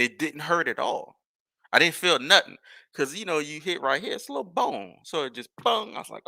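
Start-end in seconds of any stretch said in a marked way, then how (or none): none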